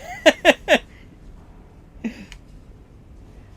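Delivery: breathy giggle at own flop; laughing. Real, not acted. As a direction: laugh it off, high and airless. Laughing after the accent bit.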